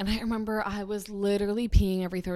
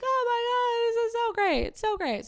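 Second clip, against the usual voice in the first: high-pitched imitation of gushing relatives; mock-thrilled congratulations; excited family; 14 semitones higher than usual. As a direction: gush in a put-on high voice. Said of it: "they're all like".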